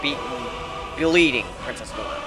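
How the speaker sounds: ordinary delivery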